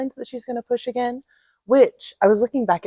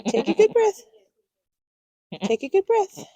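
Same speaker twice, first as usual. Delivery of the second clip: gentle coaxing; 12 semitones higher than usual. Real, not acted. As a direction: coax the newborn to breathe. Coaxing newborn to breathe.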